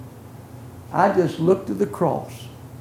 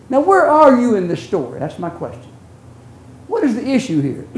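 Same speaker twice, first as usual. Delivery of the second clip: ordinary delivery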